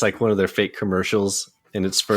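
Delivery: ordinary talk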